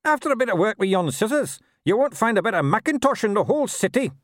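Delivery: high, sly gnome sneering; a nasty little gnome gloating. A narrator's reading. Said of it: "and laughed nastily".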